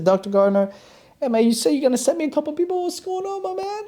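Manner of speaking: high, fast, fed-up complaint; pressing him on the phone, half pleading; exasperated call. Retold or imitated. Confronting the doctor on the phone.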